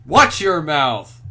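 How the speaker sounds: loud, slow scold; sharp scolding. Acted. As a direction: bark it at her slowly. Scolding the girl.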